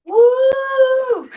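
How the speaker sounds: high, loud whoop of delight; excited whoop. Real, not acted. Guest cheering the host's big introduction, excited whoop. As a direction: cheer like you just got introduced on stage.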